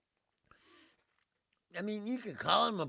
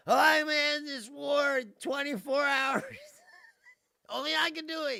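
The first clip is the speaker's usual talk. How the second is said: loud, higher hammed-up boast; mock Trump boast; 6 semitones higher, 5 dB louder than usual. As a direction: brag like a blustering president. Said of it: "remember he says".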